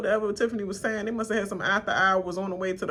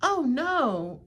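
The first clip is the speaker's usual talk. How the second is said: mock fake dismay; 7 semitones higher than usual. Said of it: "you was all like".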